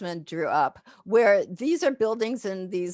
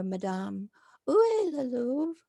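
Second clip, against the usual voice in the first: polite French question; 6 semitones higher than usual. Shown as a demonstration. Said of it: "because I didn't say".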